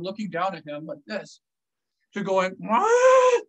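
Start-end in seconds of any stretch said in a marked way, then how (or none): 2.6-3.4 loud, high imitated bear cry; freaked-out bear. Bear startled by rifle fire, loud high cry. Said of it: "The bear freaks out".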